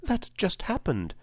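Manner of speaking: put-on high little-girl voice, unadorned; simple, innocent. Dorothy explains killing the witch to Oz, simply. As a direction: answer simply, as a child would. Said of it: "returned Dorothy simply".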